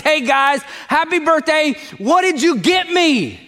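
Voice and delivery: high, slow, grabby "what did you get me"; hammed-up entitled whine at a birthday; greedy party guest. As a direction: play the self-centred guest who only wants presents.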